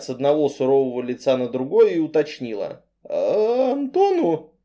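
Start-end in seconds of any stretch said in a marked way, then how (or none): 3.0-4.5 high, bewildered little question. Acted. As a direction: ask it completely baffled. Bewildered heroine asks.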